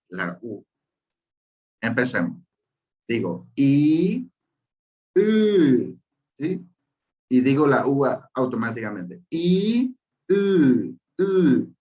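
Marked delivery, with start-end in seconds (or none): 3.1-6.0 slow drawn-out I, then a high U; vowel drill. Shown as a demonstration. Teacher slowly demonstrating vowels to a class. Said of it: "Ahí está la pronunciación". 9.3-11.7 "I" glides into a higher, tense "U"; vowel demo. Shown as a demonstration. Teacher modelling the vowel technique for students. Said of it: "Ahí está la pronunciación".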